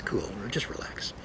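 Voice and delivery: breathy hushed whisper; soft whispered reassurance. Shown as a demonstration. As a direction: whisper it like a calming secret.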